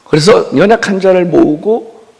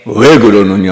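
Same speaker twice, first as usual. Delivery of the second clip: a lower, grave reply; Jesus answering; 8 semitones lower than usual. Retold or imitated. Jesus answering Martha, lightly voiced lower. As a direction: answer as a patient lord, a touch deeper.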